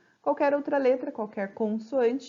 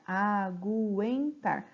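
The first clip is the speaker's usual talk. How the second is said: aguentar stretched very slowly, a-guen-tar with the U clearly sounded; slow demo; much slower than usual. Shown as a demonstration. Teacher slowly sounding out aguentar to show the U. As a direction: draw out aguentar so the U is heard. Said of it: "mas eu escuto o som do U".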